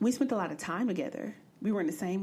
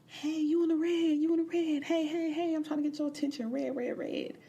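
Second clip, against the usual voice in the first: put-on catcalling boy; 9 semitones higher than usual. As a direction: mimic a boy yelling at girls. Boys catcalling from across street.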